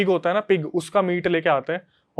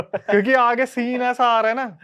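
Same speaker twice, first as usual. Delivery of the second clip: real amusement, pitched higher; laughing while talking; 6 semitones higher than usual. Real, not acted. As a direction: talk through laughter, voice riding up. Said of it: "आप ही हंस रहे हो". (हँसते हुए बोलना)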